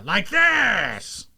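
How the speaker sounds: high, long drawn-out villain cry; hammed-up cartoon pose call; Captain Ginyu. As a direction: stretch the last word into a showy shout. Repeating Ginyu's battle cry.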